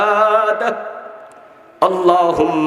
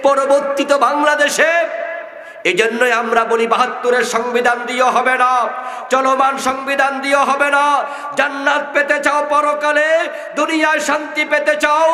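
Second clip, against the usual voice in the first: rising fiery preaching; 9 semitones higher than usual. Real, not acted. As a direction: preach harder line by line. Fiery sermon building to climax.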